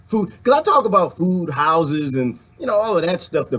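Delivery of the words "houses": ordinary delivery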